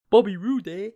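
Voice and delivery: put-on playful Canadian accent with a loud, high "eh"; joking Canadian accent; mock Canadian accent. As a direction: ham up a Canadian "eh?". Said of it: "'cause he's Canadian".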